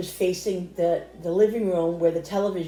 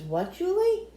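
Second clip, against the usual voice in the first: surprised question; 12 semitones higher, slower than usual. Retold or imitated. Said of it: "I says".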